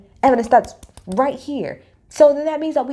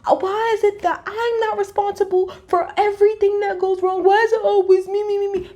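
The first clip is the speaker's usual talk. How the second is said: high, weepy self-pity; mock crying Jen; 10 semitones higher than usual. Mimicking Jen whining to Whitney and Heather, high and weepy. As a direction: wail it like a fake sob. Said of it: "she was like".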